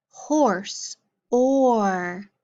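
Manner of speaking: "horse" then its vowel "or" alone, drawn out; sound card drill. Shown as a demonstration. Teacher drilling sound cards with the class, vowel held. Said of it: "get ready for our sound cards".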